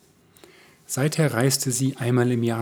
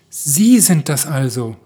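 lauter, langsamer Ausruf; rief aus; 4 semitones higher, 9 dB louder, slower than usual. A narrator's reading. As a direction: ruft es überrascht und erfreut aus. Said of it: "rief er". (laut und langsam ausgerufen)

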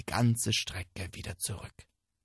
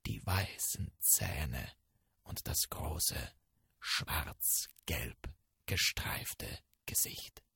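low menacing whisper; 10 semitones lower, 9 dB quieter, slower than usual. A narrator's reading. Tiger wird bedrohlich beschrieben.

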